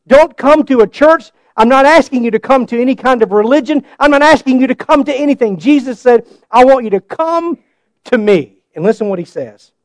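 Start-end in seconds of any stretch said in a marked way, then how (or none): 0.1-8.6 higher, louder fervent preaching. Acted. Preacher pleading with the congregation, fervent and raised. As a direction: press the invitation home.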